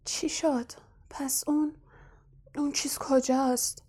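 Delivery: dazed, breathless whisper; barely voiced, just coming round. Harry waking on the train, whispering in confusion.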